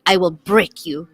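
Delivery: low, slow Russian accent demo. Shown as a demonstration. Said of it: "Can you say in a Russian accent".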